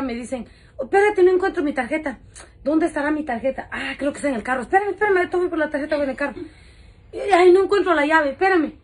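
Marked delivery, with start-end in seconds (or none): 0.8-6.3 high, raised voice hunting for something; flustered customer. Retold or imitated. Customer looking for her card while on the phone, flustered. 7.1-8.7 loud, high mock fluster; flustered customer. Customer who cannot find the car key, flustered.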